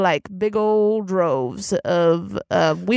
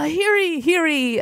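town crier; 10 semitones higher than usual. Acted. Beef announces speech clinking glass.

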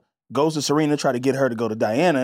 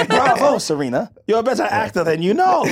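lightly mocking grand praise; a hint of theatrical villain; light mock of a scheming socialite; quoting Diana again; 6 semitones higher than usual. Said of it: "Diana goes".

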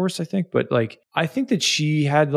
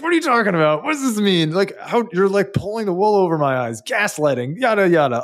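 higher, louder, heated demands; retold angry yelling; 7 semitones higher, 3 dB louder than usual. On the street, yelling back at his girlfriend over the phone, hammed-up anger. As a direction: fire questions back at her in a mock rage. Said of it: "yelling back at her".

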